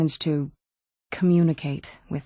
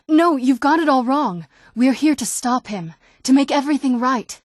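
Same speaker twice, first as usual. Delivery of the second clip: heated correction; 6 semitones higher than usual. Acted. Correcting a mistaken accusation.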